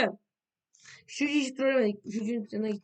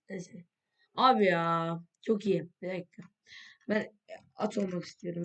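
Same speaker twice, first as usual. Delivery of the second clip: ordinary talk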